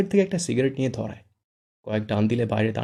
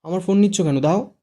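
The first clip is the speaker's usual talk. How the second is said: high, loud protesting yell; shouting; 8 semitones higher, 7 dB louder than usual. A narrator's reading. Fara yelling at Shuddho for taking her phone. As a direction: shout to get the phone back. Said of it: "ফারা চেঁচিয়ে ওঠে".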